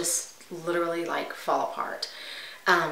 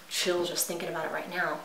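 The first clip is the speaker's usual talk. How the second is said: usual speaking voice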